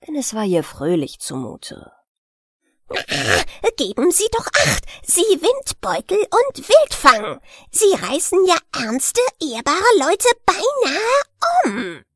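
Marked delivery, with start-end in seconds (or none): 3.8-12.0 much higher and louder, an indignant shriek; an outraged old willow stump; furious scolding. A narrator's reading. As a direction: rage at the careless wildcat, slowing on the end. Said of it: "die erzürnte Stimme".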